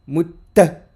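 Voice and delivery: Mutta with a held, doubled tt; short, pressed demo of Mutta; insist on the double t. Shown as a demonstration. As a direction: lean into the tt of Mutta. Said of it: "On insiste".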